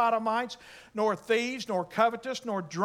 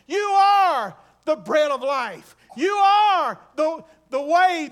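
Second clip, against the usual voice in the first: building litany, louder and higher, each "You are" hammered; preaching at full force; 4 semitones higher, 8 dB louder than usual. Acted. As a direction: rise in fervour with every name of God. Preacher lists names of God.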